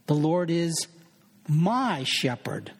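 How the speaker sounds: "my" drawn out slowly after a pause; wait, then lean long on "my"; stress on my. Shown as a demonstration. Preacher showing it's an intimate personal thing, stress on "my". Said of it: "emphasize different words in a given verse".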